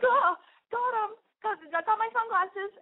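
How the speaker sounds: very high, loud mock fluster; hammed-up squeaky-high relief in a panic; frantic scrambling. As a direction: act out a desperate scramble. Retold frantic scramble for sunglasses.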